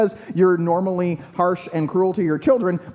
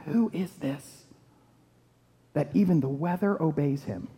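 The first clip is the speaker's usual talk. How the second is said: soft, awestruck wondering; hushed awe; 6 dB quieter than usual. Acted. Disciples in the boat after the calm, hushed awe. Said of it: "respond in awe".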